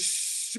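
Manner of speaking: soft voiceless hiss; quiet mimicked jet of water; imitated water spray.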